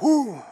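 high, loud blowing-off-steam whoo; loud exasperated whoo. Retold or imitated. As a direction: let the anger burst out. Boss exhales angrily.

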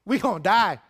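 high, loud cry of alarm; frantic, high-pitched shout for help; panicked disciples. Acted. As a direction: wail to Jesus that death is coming. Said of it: "here's a modern translation for you".

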